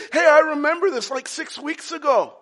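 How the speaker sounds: high, loud burst of recognition; excited Elijah. Acted. Preacher voices Elijah recognising the fire, excited. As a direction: call it out in eager recognition.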